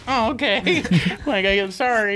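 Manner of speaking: high, exaggeratedly meek retelling; mock apology. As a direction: back out with hammed-up politeness. Mimics hosts backing out politely.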